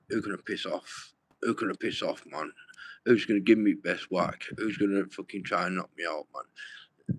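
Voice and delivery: low, hushed mutter, fading to a breath; muttered thoughts. Retold or imitated. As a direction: mutter it to yourself, almost unheard. Retold inner thoughts, muttered.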